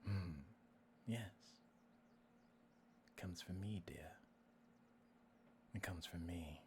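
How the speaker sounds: low, near-whispered menace; hushed villain, pausing between phrases; creepy voice. Acted. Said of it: "you creepy voice".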